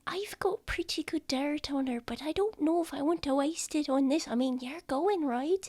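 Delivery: high, hushed, confiding; whispering little child. Acted. Child debating dirt on mum, high and hushed. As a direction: scheme in a small high whisper.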